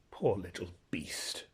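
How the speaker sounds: slow, soft, near-whispered pity; gentler tone. Acted. Horse pitying the young boy, gently. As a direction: soften right down, tender with the boy. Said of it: "said the horse in a gentler tone".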